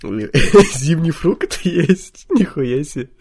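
slow incredulous question; surprised. Real, not acted. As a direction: stretch it out in disbelief. Astonished at odd question.

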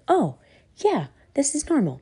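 lightly acted, high-pitched realisation; body's voice. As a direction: start high, as if it just clicked. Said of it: "body can be like".

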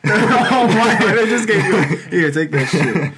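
real laughter breaking through the words; laughing. Real, not acted. Cracking up while retelling lunch.

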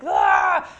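high loud yell of shock. Retold or imitated. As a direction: let out a sharp yell. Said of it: "I yelled".

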